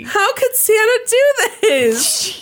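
high, slow, laughing whine; mock heartbroken child. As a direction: whimper it like a betrayed kid.

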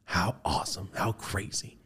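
hushed awed whisper in the car; whispering to himself. Retold or imitated. Alone in his car after meeting a fan, whispering in awe. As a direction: breathe it out in an amazed whisper. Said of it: "I go back in my car like".

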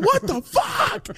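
very high, slow disbelief; shocked Charlie. Retold or imitated. Charlie reacting to the prank, slow shocked disbelief. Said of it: "you can hear him".

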